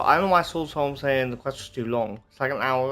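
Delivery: ordinary talk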